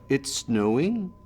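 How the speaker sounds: "snowing" drawn out and lifted like a question; Canadian rise. Shown as a demonstration. Explaining how Canadians talk, questioning rise on "snowing". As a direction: say a plain statement, lifting "snowing" at the end. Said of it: "every statement sounds like a question".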